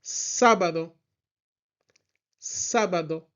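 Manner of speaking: "Sábado" with the first syllable punched; teacher's clear "Sábado", accent on SÁ; stress on first A. Shown as a demonstration. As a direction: lean on the "Sá" of "Sábado". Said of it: "we have another accent or tilde".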